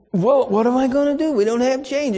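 put-on a young cashier caught out, sheepishly excusing herself. Cashier voiced while being yelled at.

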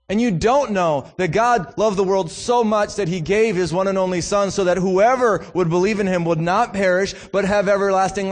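driving, high-pitched pulpit appeal; impassioned preaching. Real, not acted. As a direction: preach with fervour, pressing the plea. Preaches with rising intensity.

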